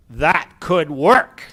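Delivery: loud, slow, drawn-out impression of an excited dad; mock excitement in a dad's voice; excited dad impersonation. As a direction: imitate your dad exclaiming, stretching each word out loudly. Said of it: "that's my dad impersonation".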